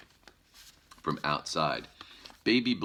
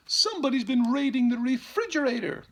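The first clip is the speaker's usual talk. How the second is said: much higher, louder mother bear voice; 6 dB louder than usual. Acted. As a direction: squeak it out like a fussy mother bear. Missus Blair voiced in story.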